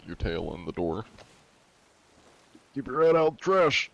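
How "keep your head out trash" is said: high, loud playful yell at the cat; mock scolding yell. Real, not acted. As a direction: shoo the cat out like a cranky shopkeeper. Yelling at cat in doorway.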